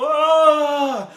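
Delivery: huge high shout to the sky; mock battle roar. Mocking the movie's heroes with a sky roar. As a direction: roar it out like a barbarian lifting a trophy. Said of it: "people go".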